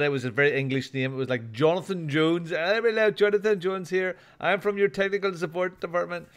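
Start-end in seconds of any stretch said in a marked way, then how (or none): 2.5-6.3 mimicking a scam caller with an Indian accent. Tells co-hosts about a scam call, mock Indian accent. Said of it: "very clearly a Pakistani Indian call center".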